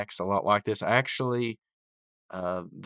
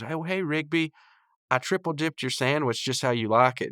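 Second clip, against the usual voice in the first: lightly voiced higher, eager waitress; Eileen; 7 semitones higher than usual. Waitress to her crush Rigby, lightly imitated eager greeting. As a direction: imitate a hint of a sweet-talking admirer.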